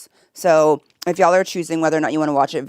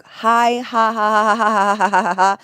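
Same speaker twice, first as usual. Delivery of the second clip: high, loud villain cackle; supervillain laugh; 5 semitones higher, 3 dB louder than usual. Retold or imitated. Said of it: "You sound like a supervillain".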